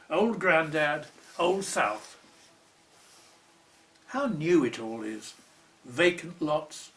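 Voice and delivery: measured, deliberate poetry reading; poem reading. Acted. Poet reading his own poem to an interviewer, measured. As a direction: read each line as if placing it carefully.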